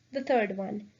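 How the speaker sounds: normal talking voice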